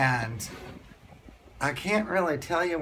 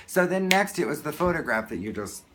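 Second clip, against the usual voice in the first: ordinary delivery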